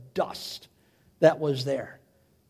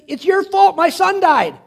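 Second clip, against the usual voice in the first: high, louder mock accusation; accusing wail; 10 semitones higher, 5 dB louder than usual. Grieving widow to the prophet over her son, accusing. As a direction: imitate a bereaved mother's blame, pitched well up. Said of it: "she basically blames Elijah".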